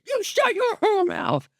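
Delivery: high, slow squeaky voice; hammed-up high cartoon voice; high character impression. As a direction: squeak the line slowly up high. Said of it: "quoting the character".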